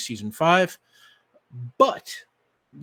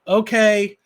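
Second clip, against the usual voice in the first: loud, higher call down from the air; louder call to end the game; 7 semitones higher, 12 dB louder than usual. Acted. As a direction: call down to her from above. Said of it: "he calls out".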